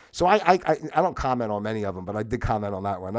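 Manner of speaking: ordinary talk, nothing marked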